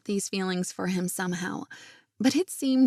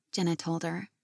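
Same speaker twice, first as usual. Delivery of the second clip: ordinary delivery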